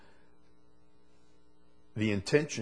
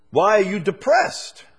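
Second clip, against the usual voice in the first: God's question; 8 semitones higher, 9 dB louder than usual. Retold or imitated. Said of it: "would be God's question to him".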